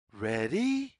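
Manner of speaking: usual speaking voice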